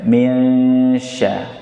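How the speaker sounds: minsya drawn out slowly with a falling end; model reading. Shown as a demonstration. Teacher modelling minsya slowly. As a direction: linger on minsya. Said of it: "Lanjut, lanjut".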